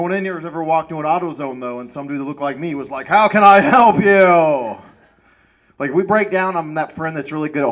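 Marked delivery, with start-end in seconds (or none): 3.1-4.8 slow, louder clerk voice; slow helpful clerk. Retold or imitated. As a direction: greet the customer slowly. Imitating auto store clerk.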